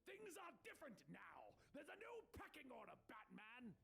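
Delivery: high-pitched defiant yell; squawking, theatrical defiance; Penguin. A narrator's reading. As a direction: protest like a cornered villain. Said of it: "and Penguin yells".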